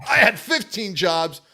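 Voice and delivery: mock-reading Jeff's comment in a high voice; Jeff's yelling voice. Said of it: "Read this in Jeff's voice".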